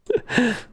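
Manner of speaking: quick high amused laugh; a brief burst of laughter at the silly clue; short laugh. Real, not acted. Laughs at turn right sign.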